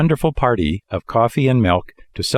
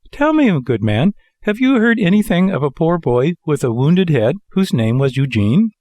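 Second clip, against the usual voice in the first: anxious puppet voice; 7 semitones higher than usual. A narrator's reading. Pinocchio asks the old man.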